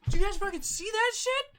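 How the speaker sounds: very high, squealed disbelief; over-the-top amazement. Acted. Game master echoes the starstruck character, high mock freak-out.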